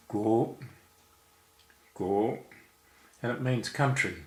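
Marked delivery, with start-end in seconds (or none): 0.1-2.4 each "Guo" climbing in pitch; rising tone. Shown as a demonstration. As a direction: demonstrate Mandarin second tone on "Guo". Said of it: "which is a rising tone".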